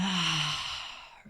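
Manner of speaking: long, airy sigh out; relaxing exhale. Shown as a demonstration. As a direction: breathe out fully and let it fade. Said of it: "relaxing and exhaling".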